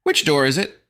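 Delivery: put-on anxious kid voice; urgent boy. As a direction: demand it quickly. Fancy Pants urgent.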